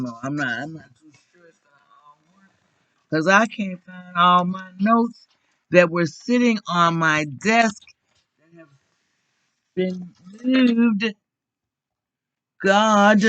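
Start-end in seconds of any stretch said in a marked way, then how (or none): 3.1-13.2 louder and higher, fed up that her notes were moved; exasperated outburst. Real, not acted. Speaker to the meeting, annoyed her notes were moved, exasperated loud complaint. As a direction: let the frustration burst out over the lost papers.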